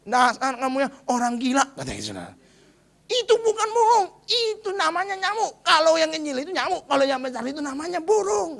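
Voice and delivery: irritated man snapping at madman; angry scolding. Acted. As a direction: tell him off, fed up. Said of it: "Marah dia akhirnya".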